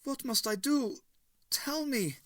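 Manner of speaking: a beaten man begging for help; high frightened pleading; frightened plea. A narrator's reading. Frightened Hitler.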